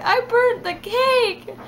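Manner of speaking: high, slow whimpering wail; hammed-up tearful complaint; mock bawling. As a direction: whine it out like a crying child. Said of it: "I just start bawling".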